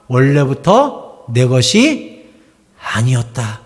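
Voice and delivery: slow, low, hushed declaration of faith; slow solemn confession. Acted. Pastor voicing Abraham's humble confession. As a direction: say it slowly and humbly, like a vow.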